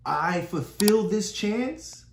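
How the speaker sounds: ordinary delivery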